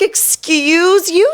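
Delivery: high, slow indignant "excuse you"; offended retort. Retold or imitated. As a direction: draw it out, scandalized. Offended retort to rude guy.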